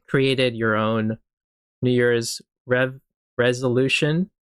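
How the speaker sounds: slow, word-by-word delivery with pauses; careful, halting pace. Real, not acted. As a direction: pick through the words carefully to get them right. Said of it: "had to say it slowly".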